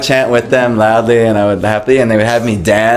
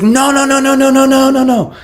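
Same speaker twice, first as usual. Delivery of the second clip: horrified. Retold or imitated. The man who brought him stops the book purchase, horrified. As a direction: panic to stop the purchase. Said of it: "and he's looking in horror".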